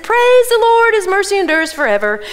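high, loud cry of praise; jubilant praise. Acted. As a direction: lift the voice in joyful praise. Singers praising before the army.

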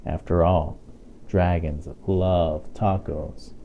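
plain reading voice

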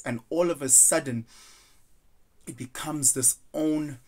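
ordinary delivery

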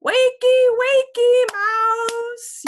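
very high, loud wake-up yell. Acted. Kitten Max yells to wake the monster while bouncing. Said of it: "yells Max".

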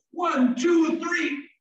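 put-on high, showy build-up for children; excited countdown. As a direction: count down as if to a big race. Counting down snail's turbo demonstration.

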